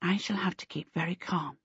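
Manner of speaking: soft, whispered self-talk; inner thought. A narrator's reading. Charmaine telling herself to stay calm, whispered. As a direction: murmur it to yourself. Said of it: "Charmaine thought".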